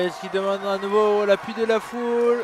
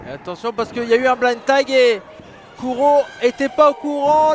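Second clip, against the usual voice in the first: rising excited ringside call; wrestling commentator; 3 semitones higher, 4 dB louder than usual. Real, not acted. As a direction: call the tag combo live. Live wrestling play-by-play. (commentateur de catch enthousiaste)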